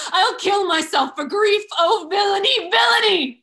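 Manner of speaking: anguished cry. Acted. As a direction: wail it, grief spilling over. Emilia's grief breaks out.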